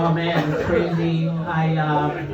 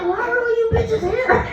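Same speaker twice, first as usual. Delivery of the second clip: high-pitched comic complaint; mock exasperation; 11 semitones higher than usual. Mom in a crowded change room, mock exasperation. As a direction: wail it in hammed-up disbelief.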